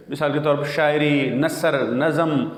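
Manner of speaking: ordinary delivery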